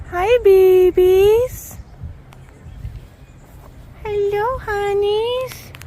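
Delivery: high, slow sweet coo. Real, not acted. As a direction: coo to the little deer. Talking to deer.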